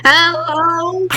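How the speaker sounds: mocking echo hello. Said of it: "The other hand was just like".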